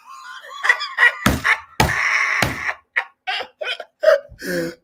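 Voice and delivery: high, loud laughing fit; roaring real laughter; long loud laugh. Real, not acted. Laughing at the clip.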